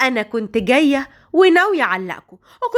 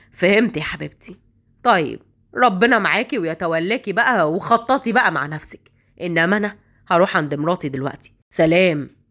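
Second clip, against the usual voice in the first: lover voiced much lower, easygoing; low male voice; 6 semitones lower than usual. Lover ending the call with his girlfriend, low and casual. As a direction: shrug it off like a man heading home to his wife.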